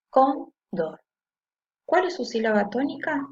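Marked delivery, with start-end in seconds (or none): 0.1-1.0 "cóndor" split as "cón", pause, "dor"; syllable by syllable. Shown as a demonstration. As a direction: break "cóndor" into two separate syllables. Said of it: "Yo la separo en sílabas".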